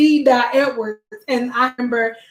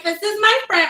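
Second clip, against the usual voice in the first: high, curt intro of a friend; just "this is my friend", pitched up; mock bare introduction; 9 semitones higher than usual. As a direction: toss it off in a high voice. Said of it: "But no, I would be like".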